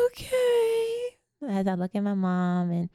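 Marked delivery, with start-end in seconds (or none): 0.1-1.2 put-on very high, small child's okay; five-year-old giving in to her mother, tiny high voice; little girl's okay. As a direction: answer like a meek little kid.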